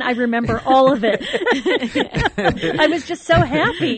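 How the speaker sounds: loud, high laughing delivery. Real, not acted. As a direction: giggle through every word. Wife laughing about drugged joy.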